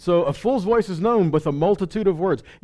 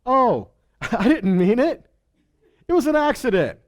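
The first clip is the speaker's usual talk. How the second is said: mock excuse; 7 semitones higher, 3 dB louder than usual. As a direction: play a guilty person laughing it off. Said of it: "Neither say before the angel".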